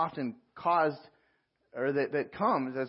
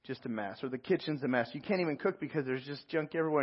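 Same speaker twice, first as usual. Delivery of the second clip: normal talking voice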